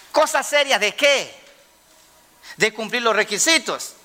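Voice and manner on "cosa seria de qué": higher, sharp challenge to the excuse; preacher mocking "Cosa seria" as an excuse; scornful retort. Real, not acted. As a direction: throw the phrase back in mockery.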